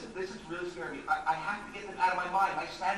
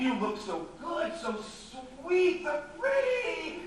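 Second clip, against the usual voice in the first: gushing flattery; 8 semitones higher, 4 dB louder, slower than usual. Acted. Flattering Sheila.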